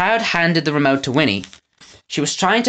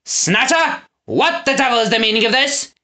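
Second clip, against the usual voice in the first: high, angry demand; thundered; 9 semitones higher than usual. A narrator's reading. Lord Portly Ryan to Snatcher, angry demand. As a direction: thunder at him. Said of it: "he thundered".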